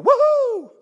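high, loud woohoo; joyful whoop. Retold or imitated. Pastor Tony whooping with joy in church, high and loud. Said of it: "I'll hear Pastor Tony go".

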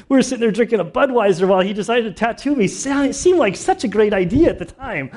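high, loud, rushing through real emotion; choked up. Real, not acted. Preacher recalling his wild past to the congregation, choked up. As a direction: push the memory out fast and high while holding back tears. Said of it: "I'm not sorry for getting choked up".

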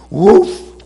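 loud breathy woof bark; one sharp canine woof; dog bark. Acted. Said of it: "barks the dog".

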